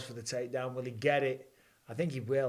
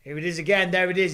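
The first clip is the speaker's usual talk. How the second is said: excited call; 4 semitones higher, 7 dB louder, faster than usual. Real, not acted. Fighter going for guillotine.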